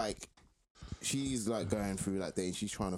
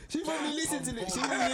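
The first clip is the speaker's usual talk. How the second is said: talking through laughter; laughing; 10 semitones higher than usual. Real, not acted. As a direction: giggle the words out. Laughing while still talking.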